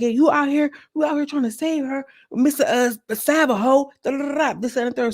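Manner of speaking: retold confrontation. Ivory confronting Sav for trying to save Renee, retold confrontation.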